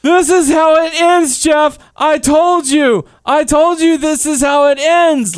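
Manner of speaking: high, loud, slowed melodramatic wrestler line; dramatic promo voice. Retold or imitated. Quoting the brother's cheesy ending promo, high and loud. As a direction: ham it up like a cheesy TNA promo.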